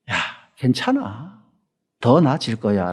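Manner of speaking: put-on whisper-close comfort to a hurt child; gentle healing words of the Lord; tender comfort.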